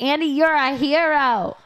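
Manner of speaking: hammed-up swooning praise; mock swoon. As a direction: gush like a smitten fan. Gushing at Andy in mock love.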